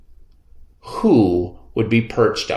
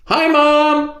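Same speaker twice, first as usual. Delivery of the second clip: high call across the park; boy calls to his running mother, high; 4 dB louder than usual. A narrator's reading. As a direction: call to mom from a distance, high. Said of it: "I called".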